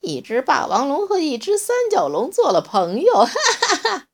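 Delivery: loud, high words breaking into laughter; laughing wildly. A narrator's reading. T-rex howling with laughter at the odd friendship. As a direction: crack up mid-sentence. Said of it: "乌提莫乐疯了".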